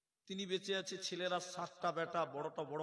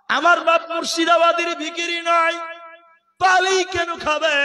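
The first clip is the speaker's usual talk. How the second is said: high, loud, slowing cry; defiant shout; 12 semitones higher, 22 dB louder than usual. Acted. Scholar defending his father before the elders, shout. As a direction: hurl it out in indignation.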